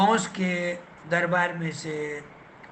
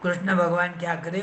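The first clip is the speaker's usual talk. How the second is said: usual speaking voice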